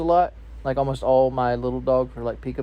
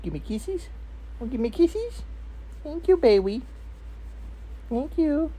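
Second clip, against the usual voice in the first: talking to a dog; 13 semitones higher than usual. Real, not acted. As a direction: coo at the puppy, way up high. Talking to his dog Bailey.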